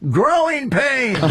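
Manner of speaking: high, slow, drawn-out call from across the room; woman from the back. Retold or imitated. A woman in the back answering her coworker, high and slow. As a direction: stretch it out in a high voice from far back. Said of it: "You hear this woman from the back go".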